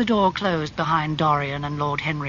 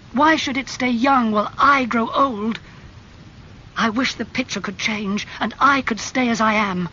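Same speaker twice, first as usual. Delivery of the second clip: raised, higher, pleading fury; angry; 7 semitones higher, 4 dB louder than usual. A narrator's reading. Dorian raging at Basil over the portrait, angry and pleading. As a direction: rise to a desperate outburst. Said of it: "his face red and angry".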